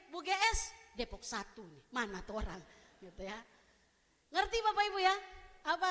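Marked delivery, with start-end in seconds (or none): 0.1-0.7 loud, raised call. Acted. Church member hailing the pastor by nickname, calling out. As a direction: shout the nickname as a greeting. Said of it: "ada yang teriak gini".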